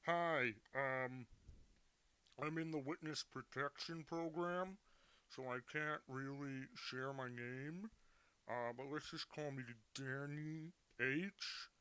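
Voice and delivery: disguised voice changer voice. Pretend. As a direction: talk through a voice changer, slowly. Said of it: "a voice changer on".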